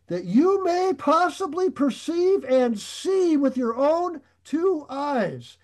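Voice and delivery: preacher rising to a climax as he reads Samuel; dramatic scripture reading. Acted. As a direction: proclaim the prophet's challenge to the people. Samuel's words read dramatically.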